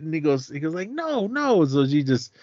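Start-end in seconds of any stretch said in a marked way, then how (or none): 0.9-1.6 retold yelling. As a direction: imitate a husband refusing. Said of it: "he's just yelling".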